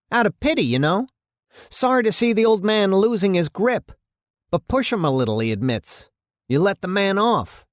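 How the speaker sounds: high-pitched, agitated mayor dressing someone down; angry mayor. Acted. Mayor berating his police chief over the case, angry.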